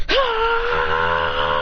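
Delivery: long sustained battle scream; attack scream. Acted. Fighter punches John from below, long attack scream. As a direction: scream all through the punch.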